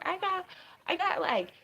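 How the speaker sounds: put-on high, loud old lady voice; grandma voice. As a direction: squeak it out like a granny. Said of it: "everybody's around smoking on their pens like this".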